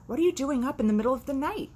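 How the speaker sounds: high, fast startled farmer; gruff farmer. Acted. Farmer catching animals awake at night, high and fast. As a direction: bark the question at the animals.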